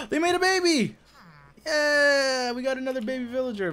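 gasp, then high, loud squeal; excited surprise. Real, not acted. Gamer discovering a new baby villager, thrilled surprise. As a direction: burst out high and loud, stretching the cheer.

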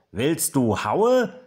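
loud, high, drawn-out challenge; slow, rising taunt from above; taunting call. A narrator's reading. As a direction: call down a playground threat. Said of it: "ruft Ben vom Klettergerüst herunter".

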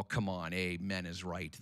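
usual speaking voice

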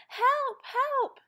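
put-on very high, loud child's cry; cry for help. A narrator's reading. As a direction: scream for help while spinning away. Child caught in the whirlpool.

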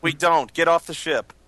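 loud order. Acted. Captain orders them off ship.

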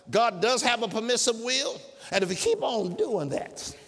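usual speaking voice

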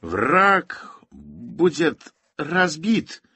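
very slow, strained high-pitched effort; labored slogan, huge gaps between words; choked out with difficulty. A narrator's reading. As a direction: force out each word separately, loudly. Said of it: "wykrztusił z trudem Bednarczyk".

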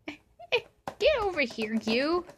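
high, loud call to a pet; calling the cat. Real, not acted. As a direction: summon the cat across the room.

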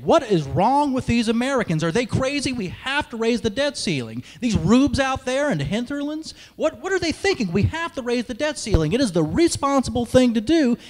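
mock a TV host baffled by the voters; Chris Matthews impression. Mocking a TV pundit who scolds the voters, incredulous and loud. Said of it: "I saw Chris Matthews last week saying".

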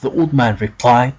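usual speaking voice

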